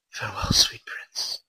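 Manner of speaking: hushed, unvoiced theatrical whisper; mock-solemn whisper; whispered farewell. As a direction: breathe it out like a mock-tragic farewell. Villain's quoted line in story.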